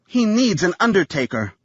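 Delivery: high, flat grim correction. A narrator's reading. Wife correcting her husband, grim and cold. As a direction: correct him coldly. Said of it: "Sarah corrected".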